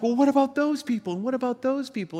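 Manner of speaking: high, rushed, acted-out protest; frantic questioning. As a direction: fire off the question fast and high, imitating a flustered swimmer. Retold frantic question to lifeguard.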